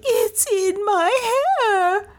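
very high, drawn-out shriek without added volume; mad scream. A narrator's reading. Lizzie feels the bat on her, screaming madly. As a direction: shriek it slowly in panic. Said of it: "she screamed madly".

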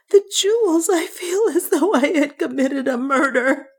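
very high, distraught woman's voice; sobbing, acted. A narrator's reading. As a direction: lament in a tearful high pitch. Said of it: "she sobbed".

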